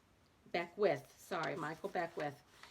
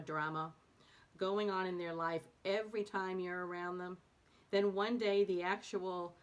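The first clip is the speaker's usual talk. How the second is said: steady, measured reading of a book chapter; calm expressive reading voice; reading aloud; 6 dB louder than usual. Acted. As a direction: read it to listeners like an audiobook.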